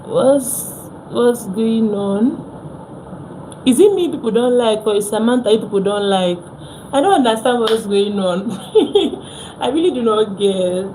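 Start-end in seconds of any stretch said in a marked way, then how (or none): none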